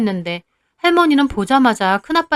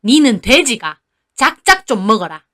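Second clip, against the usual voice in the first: scolding grandma; 3 dB louder than usual. Acted. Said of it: "할머니가 저한테 화를 내시더군요".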